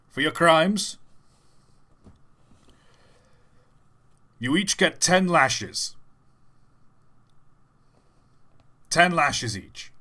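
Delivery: slow down heavily on the sentence; whip-wielding official. Retold or imitated. Man with a whip sentencing three prisoners, loud and slow. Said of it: "I don't know what accent he has".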